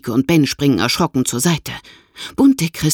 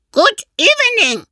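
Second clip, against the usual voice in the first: much higher, squawking parrot voice; croaking parrot; 4 dB louder than usual. A narrator's reading. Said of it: "krächzt der Papagei".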